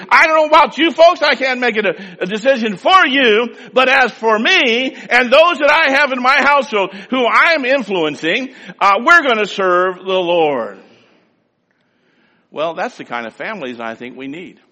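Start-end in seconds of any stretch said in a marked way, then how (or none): 0.1-10.8 loud, passionate pulpit voice; preaching at full force. Acted. Preacher urging his listeners, loud and fervent. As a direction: drive the commitment home to the congregation.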